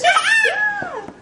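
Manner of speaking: high, loud cheer; excited shout. Real, not acted. Cheering baby's steps.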